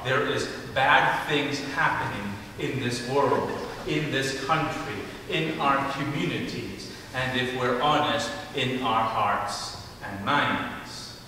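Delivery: usual speaking voice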